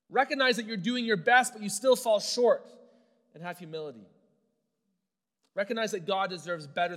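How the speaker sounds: forceful, deliberate preaching; preaching at full force. Acted. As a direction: drive the point home from the pulpit. Preacher exhorting the congregation.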